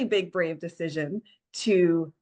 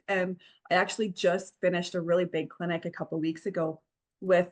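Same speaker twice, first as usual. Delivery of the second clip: usual speaking voice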